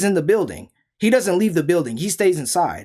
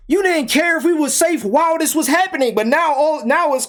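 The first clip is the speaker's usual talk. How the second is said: much higher, exasperated rant; exasperated outrage; 9 semitones higher than usual. Real, not acted. To friends who ignored the danger, high exasperated outrage. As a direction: vent at fake friends. Friends only care now.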